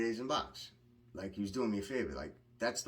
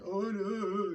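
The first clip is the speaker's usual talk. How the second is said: high, loud mock wail; hammed-up crying, pitched way up; 12 semitones higher, 7 dB louder than usual. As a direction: pretend to bawl in a raised voice. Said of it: "I wasn't crying 'cause, like".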